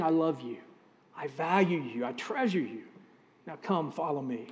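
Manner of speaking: tender voice of Jesus. Acted. Jesus calling the listener lovingly.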